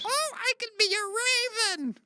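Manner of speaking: very high screechy raven; evil raven voice. Pretend. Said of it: "evil raven voice".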